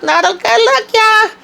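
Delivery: very high, squealed sing-song teasing; squealed tease. Real, not acted. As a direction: squeal it out as the punchline. Gossipy reaction to Fatima's arrest.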